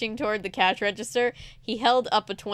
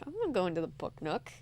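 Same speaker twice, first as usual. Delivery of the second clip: quick sneaky hush; 3 semitones lower, 9 dB quieter, faster than usual. Retold or imitated. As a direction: mutter it like a secret. Mock-whispered sneaky plan.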